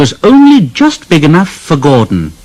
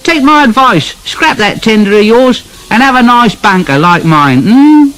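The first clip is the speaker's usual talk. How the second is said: much higher, teasing little engine voice; cheeky Thomas; 12 semitones higher than usual. Acted. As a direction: needle him cheerfully with a chuckle. Thomas teases Gordon.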